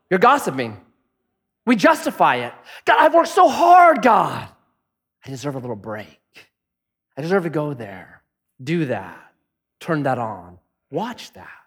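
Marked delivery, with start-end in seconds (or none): 2.8-11.6 mock self-pity. Voicing excuse-maker to God.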